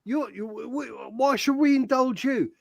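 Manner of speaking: high, loud scoffing outburst; mock indignant shout. Scoffing at co-host's opinion, mock indignant shout. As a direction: bellow at him in mock outrage. Mocking Chris's opinion as outsider.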